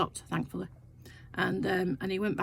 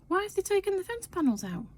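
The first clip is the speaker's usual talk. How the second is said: much higher, baffled question; surprised question; 11 semitones higher than usual. Retold or imitated. Wife noticing missing fence panels, high surprised question. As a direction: ask it in disbelief. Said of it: "I said".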